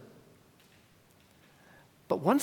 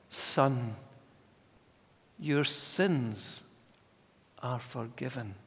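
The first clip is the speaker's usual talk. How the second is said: gentle pronouncement; 5 semitones lower, 5 dB quieter, slower than usual. Acted. Jesus quoted to paralyzed man.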